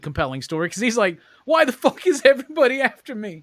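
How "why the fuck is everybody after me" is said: high, loud, frantic complaint; exasperated yell. Retold or imitated. Imitating the guy missing two fingers, loud, high and frantic. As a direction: cry out like a hunted man.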